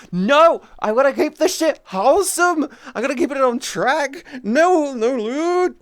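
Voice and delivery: high, loud frantic protest; Terrace voice. Retold or imitated. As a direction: play the frantic wholesome mod. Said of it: "I'm like".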